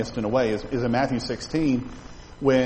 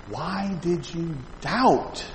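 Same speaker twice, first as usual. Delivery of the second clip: slow, hushed reproach with long pauses; solemn gentle rebuke; 7 dB quieter, much slower than usual. Acted. Jesus to Peter as he lifts him, slow soft rebuke. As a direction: let each phrase sink in, softly.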